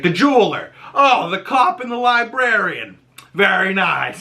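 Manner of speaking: high, lustful mock appraisal; leering admiration. Agatha admiring the naked townsfolk, high leering tone. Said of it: "She's like".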